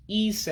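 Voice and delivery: ise drawn out as high ee-say. Shown as a demonstration. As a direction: model ise with a long ee and ay ending. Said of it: "makes an A sound in English".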